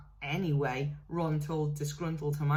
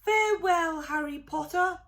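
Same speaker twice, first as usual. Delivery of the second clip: high, louder elf voice; tearful elf farewell; 11 semitones higher, 5 dB louder than usual. A narrator's reading. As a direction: bid a last goodbye. Dobby's tearful goodbye.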